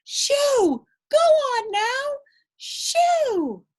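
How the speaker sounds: very high loud shoo. Acted. Fancy wedding guests shoo the dog, shrieking.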